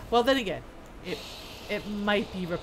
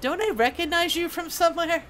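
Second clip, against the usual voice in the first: higher, louder acted question; curious questioner character; 7 semitones higher, 4 dB louder than usual. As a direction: ask it as a curious game character. Voices player's question to smith.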